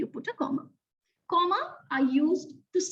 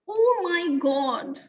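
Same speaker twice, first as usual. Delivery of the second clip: surprised; much slower than usual. Acted. Exclamation example.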